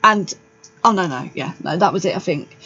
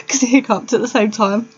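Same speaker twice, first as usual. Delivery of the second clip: laughing; 4 semitones higher than usual. Real, not acted. Laughing retelling the hiccup.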